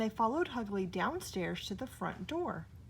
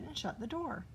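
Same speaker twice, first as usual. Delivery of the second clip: plain reading voice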